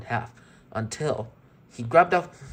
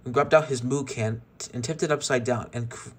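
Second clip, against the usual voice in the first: ordinary delivery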